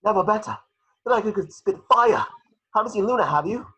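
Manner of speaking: high, loud buzzing Neville; ready to spit fire; Neville, fired up. Acted.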